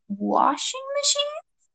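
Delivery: very high, drawn-out question; slow, squeaky bewilderment; baffled squeak. A narrator's reading. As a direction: let the voice climb in disbelief. Baffled discovery, voice shoots up.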